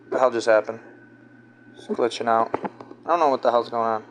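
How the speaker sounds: low, quiet mutter to oneself; muttered aside. Real, not acted. Streamer thrown by a glitch mid-rant, muttering low. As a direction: drop voice low and soft, puzzled.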